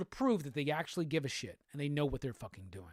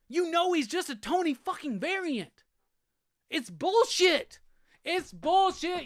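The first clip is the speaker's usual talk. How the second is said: much higher and louder, yelling at the studio; furious rant; 9 semitones higher, 4 dB louder than usual. Real, not acted. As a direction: blow up, real outrage pouring out. Fan rant erupts at Marvel.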